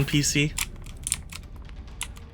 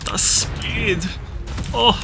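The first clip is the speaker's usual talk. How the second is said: breathy, marvelling whisper; hushed mock awe at the speed; awed whisper; 6 semitones higher, 5 dB quieter, slower than usual.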